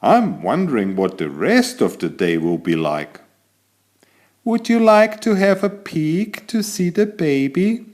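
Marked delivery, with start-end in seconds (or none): 4.4-7.9 high, put-on voice for the kangaroo mother. Kangaroo mother inviting the cat to peek at her baby, high voice. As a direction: invite him kindly, like a proud mum.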